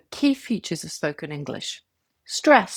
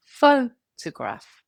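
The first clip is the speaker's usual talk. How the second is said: PHOtograph, first syllable higher, louder and drawn out; stress on first syllable; 4 semitones higher, 3 dB louder, much slower than usual. Shown as a demonstration. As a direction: say photograph slowly, leaning hard on PHO. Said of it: "The stress is on the first syllable".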